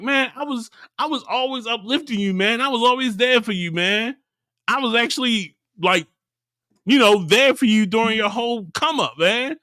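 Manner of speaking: high, pleading retold complaint; hurt protest. Pac-Man reminding his friend he was always there, mock hurt. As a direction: imitate a betrayed friend making his case.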